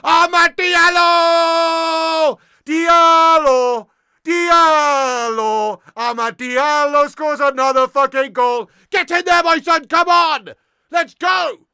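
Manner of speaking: high, very loud, long drawn-out shouts of the name; roaring, full-voiced goal call; ecstatic goal scream. Real, not acted. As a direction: bellow the scorer's name in celebration.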